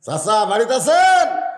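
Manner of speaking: calling at the uncle's door, rising loud. Acted. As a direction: call out to someone indoors. Calls out at uncle's house. (জোরে ডাক দিয়ে)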